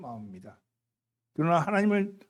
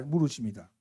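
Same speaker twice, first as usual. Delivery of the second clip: ordinary talk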